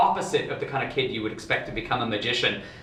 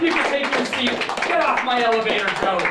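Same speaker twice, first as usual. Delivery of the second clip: loud, high showman's call; shouting over applause; 11 semitones higher, 5 dB louder than usual. Real, not acted. Magician thanks his helper as audience applauds, loud high shout. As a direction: belt it out over the clapping.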